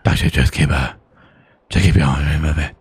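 low, fast, rough croak; raspy laryngitis voice. Pretend. Blaming laryngitis for his garbled gruff voice, fast and low. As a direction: croak it like a sore throat. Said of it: "got a bit of laryngitis".

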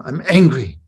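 imitating a child's loud declaration of anger; mildly mock-angry, with a loud push; grandchild's anger. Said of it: "She said".

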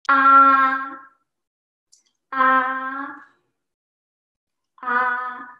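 "ah" drawn out on one steady note; high level tone. Shown as a demonstration. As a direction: keep each "ah" perfectly level. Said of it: "always stand on a high pitch".

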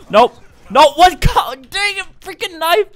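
very high, loud outburst; frustrated yell. Real, not acted. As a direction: scream in frustration at the game. Killed by a knife in game.

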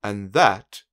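bossy scolding. A narrator's reading. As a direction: scold him like a stern bossy lady. Little Miss Bossy scolding Mister Mean.

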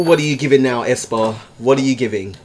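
ordinary talk, nothing marked

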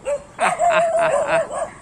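sudden high-pitched giggle; high laugh. Real, not acted. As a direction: burst into a squeaky laugh. Laughing at the dogs.

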